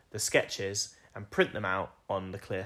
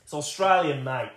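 mock Australian accent; 4 semitones higher, 6 dB louder than usual. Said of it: "You going a bit Australian".

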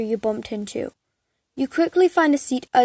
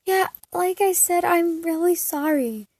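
higher pleading apology; 6 semitones higher than usual. Acted. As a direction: beg him to forgive you. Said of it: "you begged".

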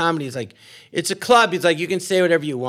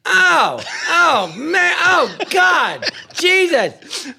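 shocked cry; 11 semitones higher, much slower than usual. Retold or imitated. Blind man crying out in bed with the lights out, high shocked yells. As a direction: cry out high, drawing out the last words.

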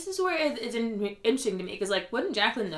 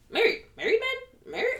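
Charisse; 14 semitones higher than usual. Retold or imitated. Podcaster imitating castmate like a barbecue grandma, baffled parroting. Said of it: "somebody's grandma at a barbecue".

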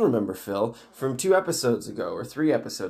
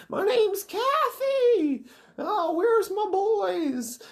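slow, very high creature wail; ghostly skeleton woman; much slower than usual. Retold or imitated. Skeleton woman reveals her name, slow high mock wail. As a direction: stretch it out in a hammed-up ghoul screech. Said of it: "she's a ghostly skeleton creature".

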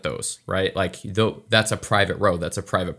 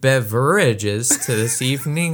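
higher, prim overly formal voice; overly proper; 6 semitones higher than usual. Acted. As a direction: act stiffly polite to a cop. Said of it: "you get all proper and shit".

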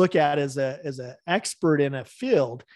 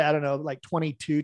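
ordinary talk

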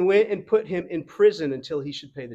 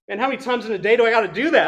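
exasperated laugh; 4 semitones higher, 8 dB louder, much faster than usual. Pretend. Exasperated preacher voicing himself.

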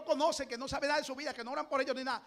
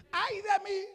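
prophet's cry; 6 semitones higher, much slower than usual. Acted. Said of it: "Caifás y Gamaliel y Anás".

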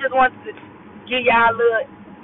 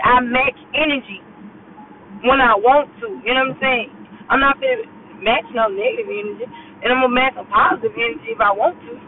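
ordinary talk, nothing marked

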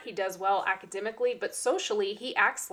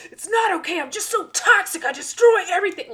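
high, loud tearful wail; mock despair; 10 semitones higher, 9 dB louder than usual. Pupil rejecting the other teacher's comfort, hammed-up wailing despair. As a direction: imitate a child in full meltdown. Retold pupil's distraught outburst.

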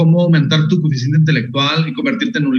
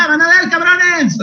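put-on high, slowed-down kid's threat; childish threat; 12 semitones higher, slower than usual. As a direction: threaten them like an angry kid. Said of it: "Y yo decía".

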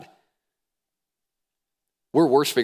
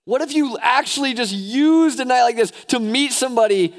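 high, loud preacher exhortation; rousing, urgent sermon delivery; impassioned preaching; 9 dB louder than usual. Real, not acted. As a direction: preach it at full force, pitched well up. Preaching at full force.

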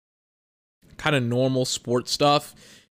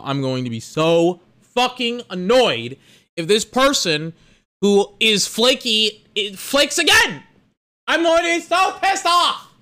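building from heated to a high shouted peak; rising fury, shouting the last line; angry rant; 6 semitones higher than usual. Real, not acted. Venting about a flaky coworker, building to a shout.

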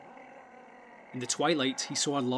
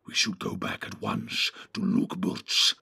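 very low, whispery orc; hushed, evil creature voice; 5 semitones lower than usual. A narrator's reading. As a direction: whisper it with sinister menace. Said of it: "said the evil voice".

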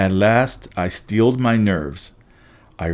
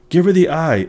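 loud, high urgent shout; screamed; 8 semitones higher, 5 dB louder than usual. A narrator's reading. Annabeth orders her friend to return the eye, loud high scream. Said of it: "Annabeth screamed".

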